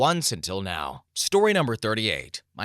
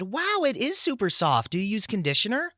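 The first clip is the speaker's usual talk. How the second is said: put-on breezy, curious young woman; girly voice; 10 semitones higher than usual. Girl at the party asking about bleached hair, high and airy.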